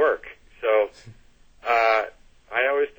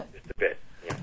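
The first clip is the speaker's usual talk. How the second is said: laughing; 10 semitones higher, faster than usual. Real, not acted. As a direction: chuckle through the words, almost breathless. Laughing at the foul-mouth joke.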